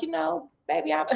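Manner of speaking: high, teasing, self-satisfied boast; playful cockiness. Retold or imitated. Sherlock brags to Detective Grayson, playfully cocky. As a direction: tease like a smug know-it-all.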